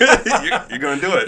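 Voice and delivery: mock teasing Colby. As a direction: push him, playfully. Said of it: "And Colby was like".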